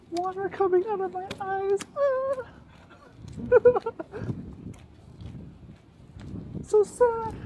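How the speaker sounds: high-pitched mock sobs close to laughter; squealed pretend weeping; fake crying. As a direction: wail the words as if bawling, half laughing. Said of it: "Are you crying or laughing?".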